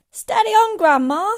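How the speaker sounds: high, loud caution; alarmed boy. A narrator's reading. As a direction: call up to calm her down. Boy cautions shouting grandma.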